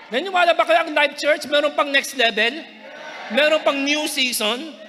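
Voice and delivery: rally the church to shout back; rising shout. Real, not acted.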